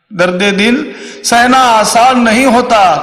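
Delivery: high-pitched, slow declaimed verse. Shown as a demonstration. Comedian repeats shayari line for effect, high slow declaiming.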